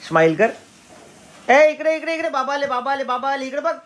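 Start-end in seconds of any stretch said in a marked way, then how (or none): 1.5-3.7 high, bright sing-song calling. Real, not acted. Calling baby to look at father.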